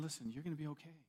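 soft, gentle comfort; hushed, tender promise to a friend; imagined reassuring Jesus. Pretend. Jesus comforts the one who denied him, soft and tender. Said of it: "He's saying".

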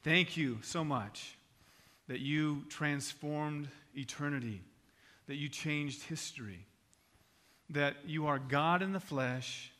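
soft, measured pastoral prayer. Real, not acted. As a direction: pray unhurriedly, lingering on phrases. Leads the congregation in prayer.

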